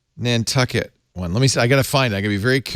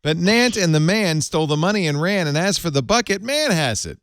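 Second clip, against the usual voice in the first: limerick recital; 4 semitones higher than usual. Shown as a demonstration. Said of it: "this is the original".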